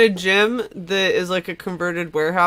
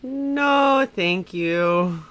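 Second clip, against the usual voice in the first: computer refusing; 3 semitones higher, much slower than usual. Retold or imitated. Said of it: "was like".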